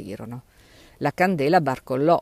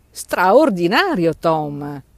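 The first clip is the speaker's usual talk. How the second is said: drawn-out, amazed and louder, pitched up; astonished exclamation; 3 semitones higher, 8 dB louder, much slower than usual. Acted. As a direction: gasp it out in wonder, slowly. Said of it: "esclamò l'altro".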